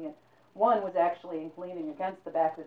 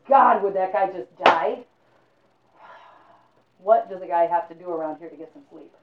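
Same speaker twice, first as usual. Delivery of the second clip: annoyed at snorer; 11 dB louder than usual. Acted. Man kept awake by a snorer, loud and fed up. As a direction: grumble loudly at the snoring.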